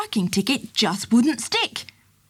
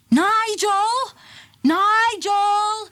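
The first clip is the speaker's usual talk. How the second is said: high carrying call, much higher; 11 semitones higher, much slower than usual. A narrator's reading. Circus man calls after his runaway elephant. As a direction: call his name down the road. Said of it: "he called after the elephant".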